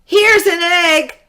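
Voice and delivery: high excited shout. A narrator's reading. Jack bringing the egg to his mother, shouting. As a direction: call it out proudly and high. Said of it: "shouted Jack".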